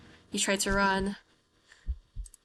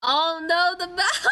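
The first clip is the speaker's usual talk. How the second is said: high, loud dismayed yelp with a laugh; shocked laughing cry; 10 semitones higher, 6 dB louder, slower than usual. Real, not acted. Gamer squeals at the mouse in the game, laughing dismay.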